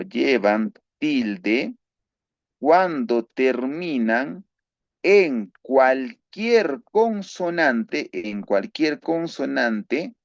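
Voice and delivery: slow, drawn-out rule dictation; dictating a grammar rule slowly. Shown as a demonstration. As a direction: spell the rule out slowly for students to copy. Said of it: "teacher dictating rule slowly".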